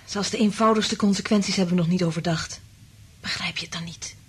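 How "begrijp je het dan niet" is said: urgent whisper. Acted. Urgent warning to Frank.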